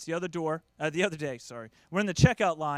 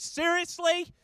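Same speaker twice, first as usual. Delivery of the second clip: high, incredulous wife; disbelieving, pitched way up; exasperated; 11 semitones higher, 3 dB louder, much slower than usual. Retold or imitated. Wife reacting to the Bad Boys lecture, high disbelief. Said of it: "My wife just says".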